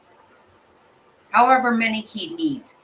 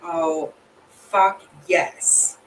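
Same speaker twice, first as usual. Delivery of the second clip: ordinary delivery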